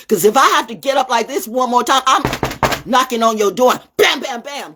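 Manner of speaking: high, loud, heated warning; loud threat. Real, not acted. Threatening to come knocking on your door, loud and heated. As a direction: raise pitch and volume as if confronting them.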